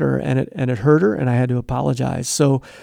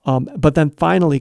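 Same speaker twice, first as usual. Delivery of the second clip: usual speaking voice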